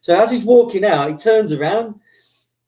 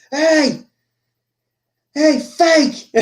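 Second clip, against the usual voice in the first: high-pitched mock shout from afar; retold heckle; 10 semitones higher, 4 dB louder, much slower than usual. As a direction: call him out across the arena, imitating a heckler. Fighter heckles from the cage.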